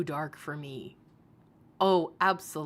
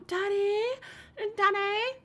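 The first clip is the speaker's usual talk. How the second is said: mock daddy calls; 13 semitones higher than usual. Mocking streamer's daddy calls.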